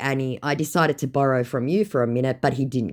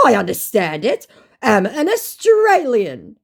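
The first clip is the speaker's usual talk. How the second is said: higher, louder, slow declaration; mock scammer insisting; 9 semitones higher, 7 dB louder than usual. Scammer claiming to be Australian, slow and pompous. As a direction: announce it like a proud liar.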